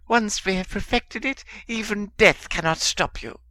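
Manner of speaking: high, fawning simper; simpering servant. A narrator's reading. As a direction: grovel in a thin high voice. Wormtail continues simpering.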